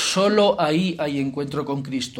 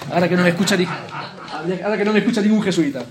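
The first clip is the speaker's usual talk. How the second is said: laughing aside; 2 semitones higher, 5 dB louder than usual. Real, not acted. Priest jokes to retreat audience about Jesuits, laughing. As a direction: let it spill out as a cheeky aside while laughing.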